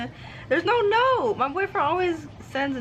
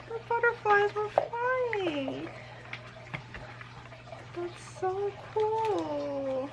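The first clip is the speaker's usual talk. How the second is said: soft, awed; 12 semitones higher, 8 dB quieter, much slower than usual. Real, not acted. Touched by the gift.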